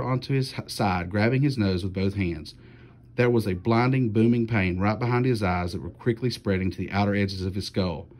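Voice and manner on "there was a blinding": usual speaking voice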